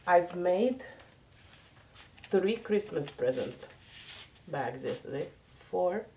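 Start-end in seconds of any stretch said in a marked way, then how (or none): none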